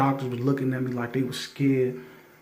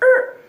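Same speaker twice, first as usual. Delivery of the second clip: a short, high, loud screeching-brakes sound; car screech noise; 7 dB louder than usual. Retold or imitated. Telling how the car pulled up, high brake screech. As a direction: mimic tires squealing to a stop. Car pulls up beside him.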